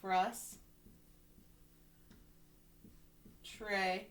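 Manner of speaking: "Frus", long pause, "tra", left hanging; split by syllable. Shown as a demonstration. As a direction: sound out "Frus-" and "tra-" as separate pieces. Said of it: "we can decode this part".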